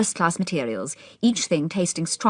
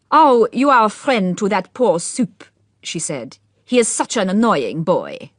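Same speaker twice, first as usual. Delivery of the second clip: put-on slow, louder French-Cockney accent; 3 semitones higher, 5 dB louder, slower than usual. A narrator's reading. Old Duchess complaining about Soupe, French-Cockney accent. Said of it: "Her accent was very strange, a mixture of French and Cockney".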